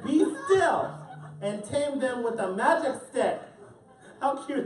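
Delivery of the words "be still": higher, louder command; commanding Max. A narrator's reading. Story reader as Max telling the wild things to be still. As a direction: tame the beasts with one order. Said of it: "Till Max said".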